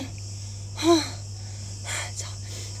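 long hot breathy exhale; panting sigh. Real, not acted. Overheated at the barbecue, a heavy panting sigh. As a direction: let out a worn-out breath. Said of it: "I'm, like, panting because I'm pretty hot".

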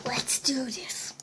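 whispered hype. Real, not acted. Pumping up to play.

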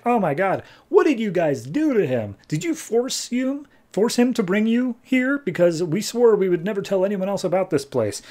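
mock-alarmed, high-pitched questioning; indignant questioning. Xanadu confronts the knights over Jason, indignant and high-pitched. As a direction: put on a high, incredulous voice, demanding answers.

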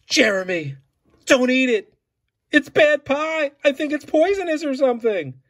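high, loud, frantic cry of warning; a boy yelling at his friend not to eat the poisoned pie; panicked warning. Acted. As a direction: shout to stop a friend before he eats. Narrator panics, warns friend off pie.

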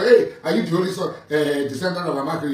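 mocking laugh. Mocking the rival's boast.

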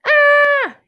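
high, loud startled cry; little monster yells in fright. Acted. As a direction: yelp in fright. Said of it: "yelled Oogle Doogle".

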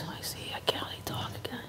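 unvoiced whisper; weak whisper after surgery. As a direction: whisper it, barely audible. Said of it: "she goes".

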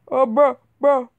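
normal talking voice

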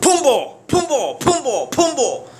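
high, slow wailing Pumbo; mock African word. Ababa cursing in an African tongue, high slow wails. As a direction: wail Pumbo like a man in despair. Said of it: "saying it in African".

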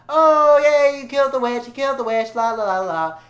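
high, loud mock celebration; exaggerated high singsong cheer; cheering Munchkins. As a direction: crow it like gleeful little Munchkins. Voicing Munchkins cheering the witch's death.

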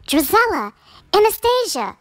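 high, loud call for the daughters. A narrator's reading. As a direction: summon both girls sharply. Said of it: "cried the stepmother".

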